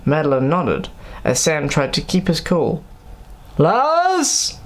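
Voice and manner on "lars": shouting for Lars from the hallway. A narrator's reading. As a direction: call out for Lars. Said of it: "shouted Sam".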